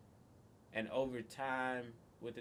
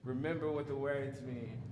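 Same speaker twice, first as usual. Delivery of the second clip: ordinary talk, nothing marked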